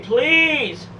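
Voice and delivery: high, strained cry of please; mock scream of please; retold scream. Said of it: "I screamed".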